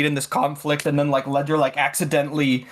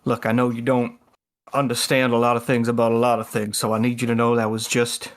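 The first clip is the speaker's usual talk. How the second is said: low, measured confiding talk; Ledger; 4 semitones lower than usual. Acted. Ledger taking Halo aside after the act, low confiding lecture. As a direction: lower the pitch and explain it in halting pieces. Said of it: "puts an arm around Halo's shoulder".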